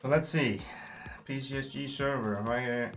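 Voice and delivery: ordinary delivery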